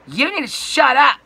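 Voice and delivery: high, very loud mock outburst; imitated yelling. Dispatch woman silencing the customer, imitated high yell. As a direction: yell it shrilly as the rude caller. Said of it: "like, just yelling at me".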